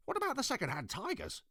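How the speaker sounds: put-on high, curious little girl. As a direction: ask it like a small girl. Ketchup Face voiced in story.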